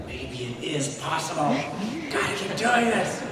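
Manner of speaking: Miss Carr. Acted. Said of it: "Miss Carr would just be like".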